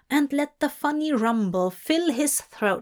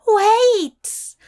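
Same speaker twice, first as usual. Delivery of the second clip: desperate shout of wait; calling out; 12 semitones higher, 7 dB louder than usual. A narrator's reading. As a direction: cry out high and loud in pain. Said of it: "Aziraphale called out".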